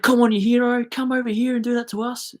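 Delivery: high, loud jeering call; taunting yell. Retold or imitated. Opposing player taunts the speaker from the boundary, jeering yell. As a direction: goad him from across the boundary.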